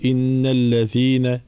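الذين wrongly said with voiceless "th" as in "think"; slow recitation, devoice the ذ in الذين to ث; dhal as tha. Shown as a demonstration. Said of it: "ثي وكأنها ثاء".

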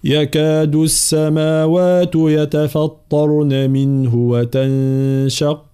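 slow melodic tajweed chant; Quran recitation. Acted. Teacher recites. (تلاوة مجودة)

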